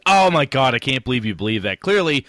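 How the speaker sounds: loud, high overblown disbelief; mock outrage. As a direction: act out an indignant online commenter, loudly. Acted outraged reply to online post.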